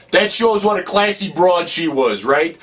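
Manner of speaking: usual speaking voice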